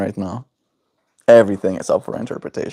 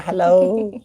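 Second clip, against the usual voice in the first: imitating the bird; 8 semitones higher than usual. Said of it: "He said".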